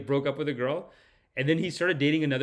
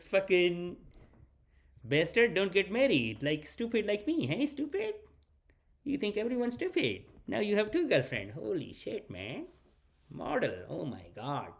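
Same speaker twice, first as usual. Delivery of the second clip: put-on dad voice, high-pitched and trailing softer; proud, scolding dad; 5 semitones higher, 4 dB quieter than usual. Buddy's dad scolding him over two girlfriends, mock-mad and proud. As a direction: play a father grumbling in grudging admiration. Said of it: "it's like he's proud of him".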